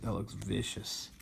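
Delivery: normal talking voice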